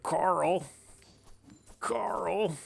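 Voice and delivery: high, drawn-out call of Carl like Rick Grimes. Retold or imitated. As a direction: call out Carl in a high imitation of the Walking Dead dad. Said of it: "Get out your Carl memes".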